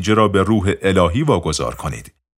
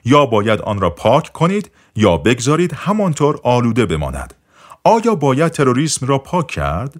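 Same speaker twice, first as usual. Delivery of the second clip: plain reading voice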